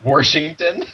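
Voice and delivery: "Washington" echoed as a questioning "Warshington"; mimicked Baltimore "Washington" with an r; echoed Baltimore pronunciation. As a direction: repeat "Washington" with an added r, rising at the end.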